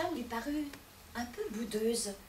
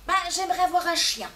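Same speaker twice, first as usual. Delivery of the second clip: eager demand; 4 semitones higher, 7 dB louder, faster than usual. Acted. Wife demands a dog.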